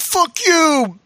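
high-pitched mock yell; imitated yell. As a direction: imitate a wrestler yelling in someone's face. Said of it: "when he's actually yelling in the match".

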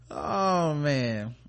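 ordinary delivery

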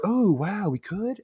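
imitated awed surprise; mock amazement. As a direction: act out gullible excitement. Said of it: "And they're like".

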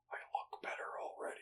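soft unvoiced whisper into the mic; giggly prank whisper. Shown as a demonstration. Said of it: "I just, like, whispered into the mic".

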